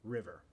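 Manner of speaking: "River" with sonorous American Rs; careful American "River"; English R. Shown as a demonstration. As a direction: say "River" as a model word, both Rs untrilled. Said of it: "Como en la palabra river".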